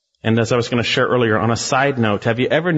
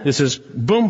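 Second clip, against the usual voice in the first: ordinary delivery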